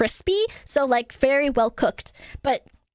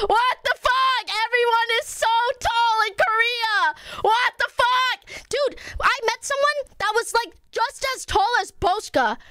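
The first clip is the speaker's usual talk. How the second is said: high-pitched wailing complaint; comic outrage; 6 semitones higher than usual. Pretend. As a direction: whine it in exaggerated disbelief. Comic outrage at everyone being tall.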